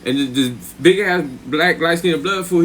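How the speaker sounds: ordinary talk, nothing marked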